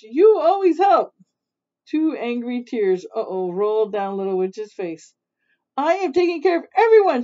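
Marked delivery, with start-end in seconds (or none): 0.0-1.1 high, loud wailing complaint; shrieking. A narrator's reading. Mother witch complains to her tired helper, shrieking. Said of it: "shrieked Mother Witch". 5.8-7.2 high, loud, fast outburst; angry cry. A narrator's reading. Overworked Little Witch protests to mother witch, angry cry. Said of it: "she cried".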